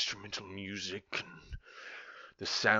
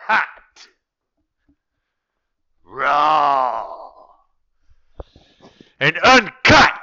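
high, loud slogan bark; radio announcer; 6 semitones higher, 19 dB louder, much slower than usual. Pretend. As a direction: punch each word like a radio promo.

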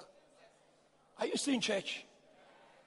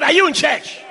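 louder repeat; 3 semitones higher, 8 dB louder than usual. Shown as a demonstration. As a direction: ask the church again, louder. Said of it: "I said".

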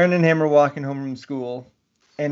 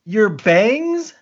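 puzzled question; 8 semitones higher, 4 dB louder than usual. Retold or imitated. Mother puzzled in retold story.

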